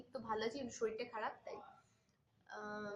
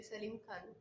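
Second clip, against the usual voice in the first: ordinary talk, nothing marked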